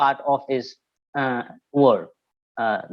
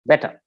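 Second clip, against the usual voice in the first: "better" with the weight on BET; stress on first syllable. Shown as a demonstration. Teacher showing where stress falls, first syllable stressed. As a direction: stress BET in "better", let ter drop. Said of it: "the stress that will be on bet".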